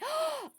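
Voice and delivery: sharp high gasp of delight; a quick surprised intake of breath. Real, not acted. Seeing the new foal, a delighted gasp.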